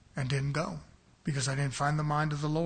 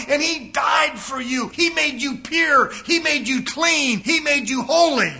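higher and loud, shouted like a fiery sermon; Satan preaching; 6 semitones higher, 8 dB louder than usual. Acted. Preacher voicing Satan's flattering sermon, shouting and much higher. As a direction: thunder it like a revival preacher. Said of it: "Satan will come to you and say".

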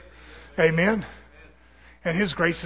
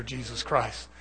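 ordinary delivery

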